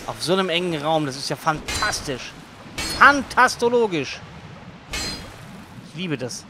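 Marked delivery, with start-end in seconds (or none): 3.0-4.2 playful. Real, not acted. Playful coined word.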